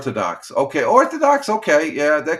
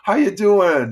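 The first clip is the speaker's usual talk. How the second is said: excited greeting; 8 semitones higher, 7 dB louder, slower than usual. Real, not acted. Welcoming chat friend.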